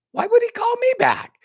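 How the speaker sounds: can't believe he'd call you; disbelieving. Retold or imitated. Young recruit doubting the big leader would call, high disbelief.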